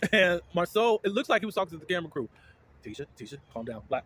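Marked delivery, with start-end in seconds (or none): 2.8-3.8 much softer, lower voice; low, soft aside; hushed calming. Retold or imitated. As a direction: imitate someone quietly settling a person down. Imitating husband calming Tisha down.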